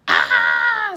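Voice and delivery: high, loud scream of terror. A narrator's reading. Kids scream at a sea serpent, loud and high. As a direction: scream as if a monster rose from the sea. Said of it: "screamed Jack and Annie".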